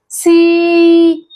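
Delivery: सी with a long, level ee; long ee sound. Shown as a demonstration. Teacher showing बड़ी ई, सी drawn right out. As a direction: hold the vowel of सी. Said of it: "बड़ी ई से".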